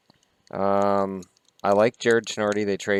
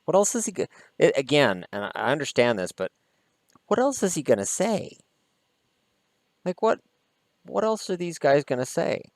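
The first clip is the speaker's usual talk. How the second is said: much higher, disbelieving "what else"; incredulous; 7 semitones higher than usual. Real, not acted. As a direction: ask it in plain disbelief. Incredulous rant about player quote.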